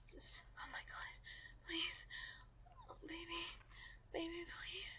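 high whispered begging; whispered pleading. Acted. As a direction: beg in a breathy whisper. Pleading during intimacy.

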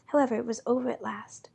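usual speaking voice

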